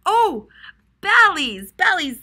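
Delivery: high, loud "oh, bellies" as it clicks; bright aha, calling out "bellies" with delight; sudden realization. Shown as a demonstration. Teacher finds the missing word for the class, excited. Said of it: "No, they weren't sliding on their bottoms".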